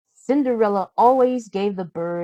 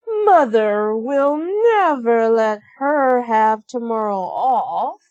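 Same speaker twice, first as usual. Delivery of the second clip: spiteful stepsister; 4 semitones higher, 5 dB louder, slower than usual. A narrator's reading. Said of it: "said Drizella".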